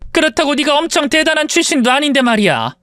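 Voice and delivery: normal talking voice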